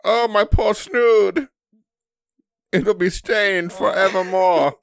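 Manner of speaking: high, slow, loud melodramatic wail; hammed-up period lament; mock tragic aristocrat. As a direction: grieve the snood like a tragedy. Period-drama parody continues.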